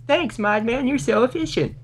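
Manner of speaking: high gushing mock thanks. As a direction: gush it in a high voice.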